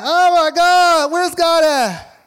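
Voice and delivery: loud, high frantic cry; mock panic. Churchgoers panicking as the building collapses, loud frantic shouting. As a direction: shout it like the building just fell in. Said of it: "And we like".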